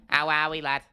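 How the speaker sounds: put-on playful Scouse accent; jokey Liverpool accent impression; Paddy the Baddy. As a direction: put on a Scouse greeting, laughing midway. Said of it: "Paddy Axon, what a scouse".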